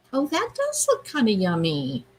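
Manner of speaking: normal talking voice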